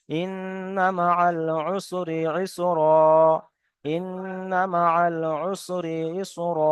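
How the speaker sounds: slow recitation ending ইসরা, no -n; drawn out, close on a long open ইসরা; Quranic recitation, stopping. Shown as a demonstration. Teacher shows the correct stop on ইসরা, slow recitation. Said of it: "এখানে পড়তে হবে".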